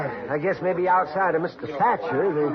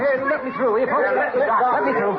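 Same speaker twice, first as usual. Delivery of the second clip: raised, higher voice calling to the crowd; loud urgent plea to make room; pushing through crowd; 10 semitones higher, 4 dB louder than usual. Acted. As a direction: call out to clear the way.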